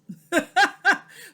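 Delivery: sudden high burst of laughter; bright laughing outburst. Real, not acted. Laughs at her own question.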